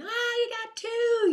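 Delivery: very high, loud, slow celebrating cheer; squealing, drawn-out praise; excited cheer. Retold or imitated. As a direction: cheer him on like he won a race. Mom celebrating son's pedals.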